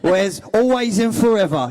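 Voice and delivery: loud, high hammed-up Cockney; Cole. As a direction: ham up a dirty Cockney. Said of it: "more of a Cockney".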